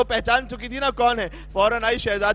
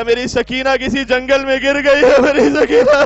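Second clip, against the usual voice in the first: louder grieving cry of a father for his daughter; anguished cry; 4 semitones higher, 9 dB louder than usual. Acted. Hussain cries for Sakina. (باپ کی سکینہ کے لیے دردناک بلند پکار)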